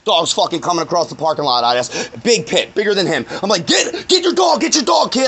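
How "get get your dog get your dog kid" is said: high-pitched urgent mock yell; retold yelling. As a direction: re-enact frantic calling to a kid, much higher. Retells yelling at the kid.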